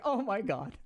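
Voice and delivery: imitating a flattered, flustered listener; lightly voiced bashful reaction; flustered gasp. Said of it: "and then they're like".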